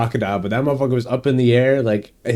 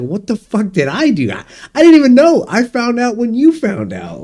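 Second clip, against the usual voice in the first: indignant protest; 9 semitones higher, 4 dB louder than usual. Acted. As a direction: voice climbing high in protest. Acting out protest to disowning kids.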